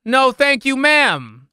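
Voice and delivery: high, loud brush-off; mock-polite shouted refusal. Player fending off demon woman, loud mock politeness.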